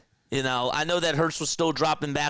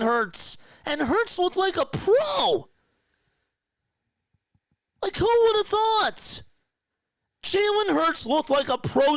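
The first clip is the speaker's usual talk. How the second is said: excited rant; 9 semitones higher than usual. Real, not acted. Fan crowing to viewers about the win, shouting excitedly. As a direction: yell your joy at the win like a diehard fan.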